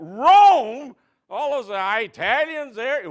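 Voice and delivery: loud, high scoff at Rome; grouchy scoffing. Retold or imitated. Hairdresser sneering at her Rome plans. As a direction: scoff in disbelief, then drag it out slow. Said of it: "She said".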